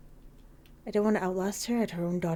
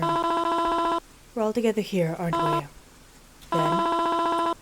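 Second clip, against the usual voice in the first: high, slow mourning mother; a frail mother lingering on every word; high drawn-out grieving mom; 10 semitones higher, much slower than usual. Acted. Mother character speaks.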